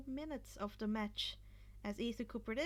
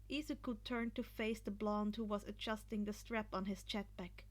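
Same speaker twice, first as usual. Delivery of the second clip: ordinary delivery, nothing marked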